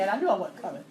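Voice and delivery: ordinary talk, nothing marked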